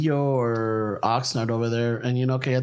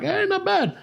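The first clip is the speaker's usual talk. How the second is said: mock compliment. Joking quote to friend.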